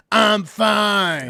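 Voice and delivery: put-on pissed-off retort; sarcastic, pissed off. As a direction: imitate a sarcastic, irritated brush-off. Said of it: "in the most sarcastic, pissed off voice".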